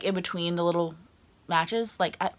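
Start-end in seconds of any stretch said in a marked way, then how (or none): none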